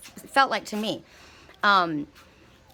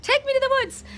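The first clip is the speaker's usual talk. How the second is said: squealed plea; 4 dB louder than usual. Retold or imitated. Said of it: "And I'm like".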